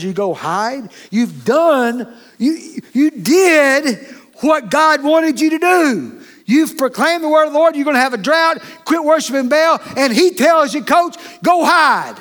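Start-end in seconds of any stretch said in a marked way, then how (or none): 1.1-10.8 louder and higher, driving each phrase home; preaching at full force. Real, not acted. Preacher rousing the congregation, full force. 10.8-12.2 slow, loud, high-pitched command; shouted command. Acted. God ordering the prophet to hide, shouted. God's command voiced by the preacher.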